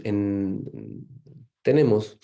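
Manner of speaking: ordinary talk, nothing marked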